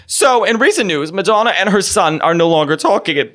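Wendy Williams impression. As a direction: turn a news item into her own sorrow. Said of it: "So she's like".

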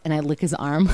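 ordinary talk, nothing marked

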